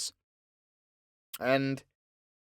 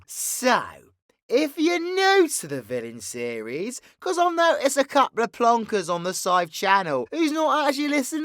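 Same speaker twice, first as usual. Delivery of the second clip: higher, louder theatrical villain showman; grandstanding villain scolding his darlings; flamboyant villain character; 11 semitones higher, 7 dB louder than usual. Acted. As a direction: stretch key words with mock-indignant flourish. V addresses the audience as villain.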